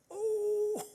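high, drawn-out "oooh"; crowd's "oooh". Acted. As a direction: hoot like a crowd seeing someone shown up. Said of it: "everybody in the crowd goes".

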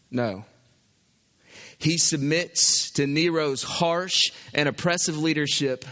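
ordinary talk, nothing marked

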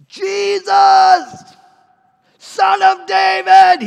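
high, drawn-out desperate shout; bellowing. Acted. Blind beggar calling to Jesus through the crowd, bellowing. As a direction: bellow it out loud over a crowd. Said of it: "Bartimaeus starts bellowing".

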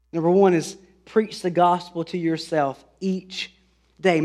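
normal talking voice

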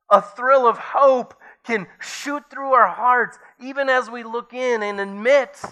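high, loud, impassioned preaching voice; fervent preaching. Acted. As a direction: preach it out with rising fire. Preacher rises into fervent climax.

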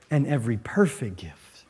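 hushed whisper; softly whispered scripture. Real, not acted. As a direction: breathe the words out. Quiet reverent close of verse.

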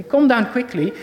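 ordinary delivery

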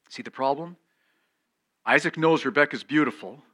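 ordinary talk

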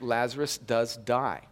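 normal talking voice

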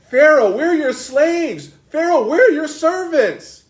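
high-pitched, drawn-out appeal to a master; pleading servants. Acted. Israel appealing to Pharaoh as his slaves, pleading. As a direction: plead up high, in mock servility.

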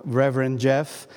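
ordinary talk

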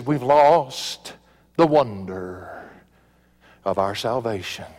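slow, low imitation of a twangy preacher; Vance Havner. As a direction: draw it out low, lightly mimicking an old preacher. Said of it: "had that little twang in his voice".

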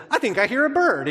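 high, loud, excitable inner voice; crazy brain's voice. Acted. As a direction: pipe up loudly like a brain imagining things. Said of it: "something in your crazy brain says".

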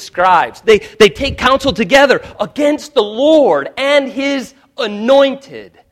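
loud, rising pulpit thunder; fiery sermon delivery; preaching at full force. Acted. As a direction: build the call to a roar.

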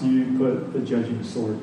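ordinary talk, nothing marked

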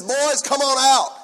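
loud, high, drawn-out holler; calling out. Acted. King calls the boys out of the furnace, hollering. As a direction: holler slowly to people some way off.

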